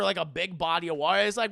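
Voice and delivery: normal talking voice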